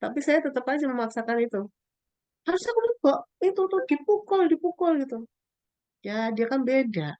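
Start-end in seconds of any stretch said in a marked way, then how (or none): 2.5-4.9 high, louder pushy scolding; insistent scolding. Retold or imitated. Mother pushing her child to hit back, high and insistent. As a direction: press the kid hard to hit back.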